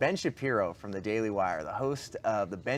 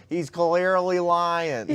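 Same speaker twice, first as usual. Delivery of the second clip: drawn-out, higher-pitched comedian's punchline; Dave Chappelle impression; 6 semitones higher, 4 dB louder, much slower than usual. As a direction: stretch it out slowly in a raised comic voice. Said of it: "a Chappelle impression".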